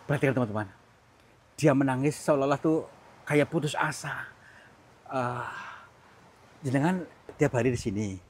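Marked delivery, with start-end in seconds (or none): none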